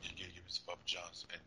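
quiet mumbled Shaq impression; barely audible. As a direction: talk way down, almost inaudible. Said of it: "I can barely hear you now".

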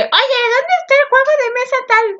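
high, altered calling voice; mock yell. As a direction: call out across the house. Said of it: "me gritó Vivi". (grito imitado, agudo, llamando desde lejos)

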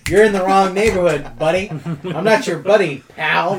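a hammed-up threat to an outsider; tough guy voice. Orcas as gangsters.

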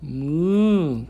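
put-on loud mooing for a toddler; cow moo. Shown as a demonstration. Parent models cow sound for toddler.

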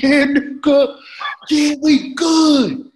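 very high, loud, drawn-out imitation of an overjoyed friend; tearful joy. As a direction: wail it high, mimicking happy tears. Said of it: "Like, tears start coming out".

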